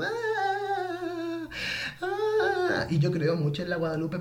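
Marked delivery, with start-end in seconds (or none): 0.0-2.9 very high sung wail; howling high cry of emotion. Retold or imitated. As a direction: let out a high, crazed crying wail. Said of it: "me pongo a llorar así como tipo loca".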